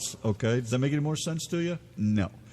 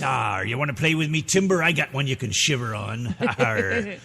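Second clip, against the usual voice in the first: pirate voice. Pretend. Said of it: "pirate talk".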